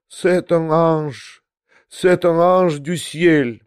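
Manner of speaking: slow tearful French, voice catching. Acted. Old prince in tears over the portrait, slow and tearful. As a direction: weep the words over the portrait.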